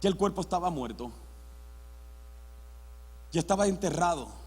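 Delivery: ordinary delivery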